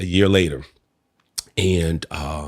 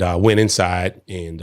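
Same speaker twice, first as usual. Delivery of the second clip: normal talking voice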